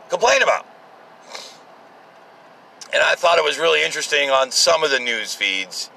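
ordinary delivery

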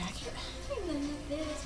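ordinary talk, nothing marked

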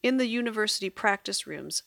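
ordinary delivery

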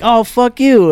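high-pitched mock angry comeback; anger over humor. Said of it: "more anger than humor".

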